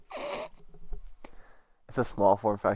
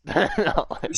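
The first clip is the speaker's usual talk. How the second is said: mocking repeat; 11 semitones higher than usual. Mocking friend's line.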